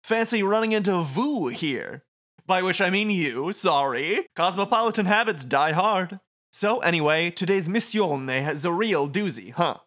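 high theatrical schmoozer; flamboyant showman voice. Acted. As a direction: schmooze over the top. Voices Motoi.

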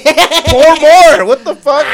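high, loud shout into the microphone; yelling into mic. Real, not acted. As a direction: blast it straight into the mic. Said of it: "Quit yelling into the microphone".

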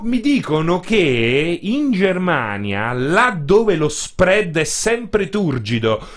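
slow, grand, pompous introduction; mock-epic announcer. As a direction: announce him like an epic documentary. (annuncio solenne e pomposo)

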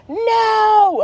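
very high, drawn-out wailed refusal; whining child. Retold or imitated. Daughter refuses mom at the park, mock tantrum. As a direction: wail it like a stubborn kid.

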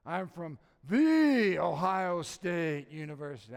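loud, slow boast with a hugely drawn-out "the"; mock the cocky alum, blasting "THE"; obnoxious Ohio State graduate. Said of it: "You get to an Ohio State graduate".

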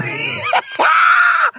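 very high squealing cackle; shrieking laugh. Real, not acted. Host laughing at the team's defeat, high shrieking laugh. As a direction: burst into a long, high-pitched shriek of laughter.

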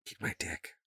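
whispered crude retort; whispering. Real, not acted. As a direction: breathe the insult in a whisper. Whispered insult trade.

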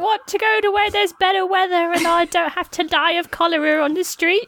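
high, chirpy Victorian urchin; a cheery orphan who doesn't get it; plucky hopeful orphan. Acted. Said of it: "Victorian orphan voice".

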